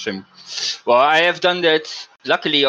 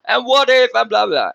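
higher, louder mock rant of an angry manager; retold shouting, higher and louder; imitated screaming; 8 semitones higher, 4 dB louder than usual. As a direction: mimic the manager yelling in your ear, half-mocking. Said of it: "and screaming".

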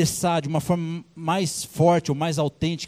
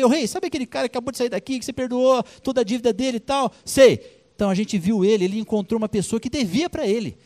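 fast, higher tattling report; eager gossiping witnesses; 6 semitones higher, faster than usual. Acted. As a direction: rush to tell the king. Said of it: "foram contar pro rei".

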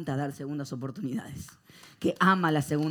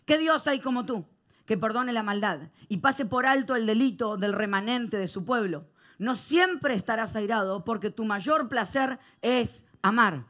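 loud, slow, ringing scripture reading; preaching scripture; 7 semitones higher, 7 dB louder, slower than usual. Acted. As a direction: proclaim it to the whole room. Lee el versículo a toda voz. (lectura bíblica predicada a viva voz)

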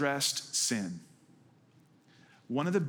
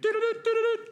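high piping flute imitation; flute toots; 4 dB louder, faster than usual. Boy playing his flute to call the sheep, high piping toots. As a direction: toot it like a little flute. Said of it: "he popped out his little flute and he went".

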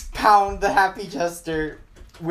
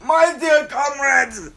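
high, drawn-out cry for help; desperate plea; 6 semitones higher, much slower than usual. Acted. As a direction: beg your comrades to save you. Monster begs comrades for help.

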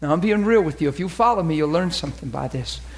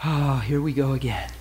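low, resigned mock sigh; acted weary dismay; heart-sinking sigh; 6 semitones lower, 3 dB quieter than usual. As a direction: sigh it out, here it comes. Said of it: "the heart goes".

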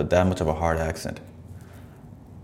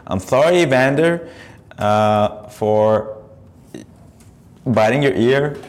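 higher, lisping mock boxer's apology; lightly done sheepish champion apology; Mike Tyson impression; 5 semitones higher, 3 dB louder, slower than usual. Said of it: "Mike Tyson reading his apology".

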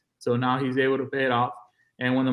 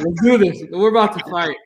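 fired-up challenge; 4 semitones higher, 7 dB louder than usual. Retold or imitated. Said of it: "I'm like".

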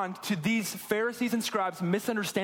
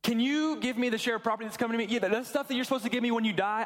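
higher-pitched, casual ask of a dad; lightly voiced son; 3 semitones higher than usual. Younger son asking his dad for the inheritance early, lightly acted casual request. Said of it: "This younger son goes to his dad, and he's like".

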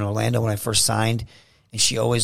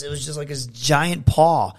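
usual speaking voice

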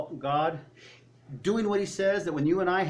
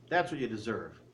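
normal talking voice

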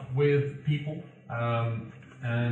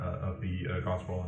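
ordinary delivery

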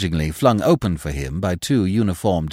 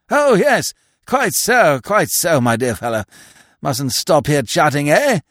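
put-on high, fluttery elderly toff; absent-minded old earl; 6 semitones higher, 5 dB louder than usual. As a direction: dither like a vague old lord. Earl rambles to companion.

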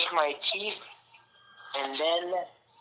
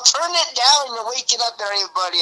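imitating an annoyed manager, higher and louder; mock manager's yell; 6 semitones higher, 3 dB louder than usual. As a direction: retell a manager's telling-off, pitched up. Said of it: "my manager yelled at me".

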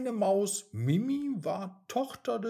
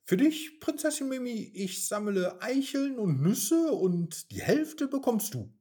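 quick, higher, eager little squirrel; 8 semitones higher, 5 dB louder than usual. Acted. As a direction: hurry to please the princess. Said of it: "antwortete das Eichhörnchen schnell". (eifriges Eichhörnchen, schnell und hoch)

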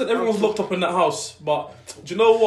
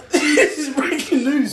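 slow words bursting through real laughter; high, drawn-out, cracking up as you speak; laughing; 7 semitones higher, slower than usual. Real, not acted. Co-host learning of friend's problem, laughing helplessly. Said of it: "I shouldn't laugh".

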